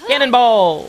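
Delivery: loud high jump shout; cannonball yell. Real, not acted. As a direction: yell it as you leap. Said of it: "watch this cannonball".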